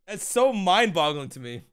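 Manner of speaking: normal talking voice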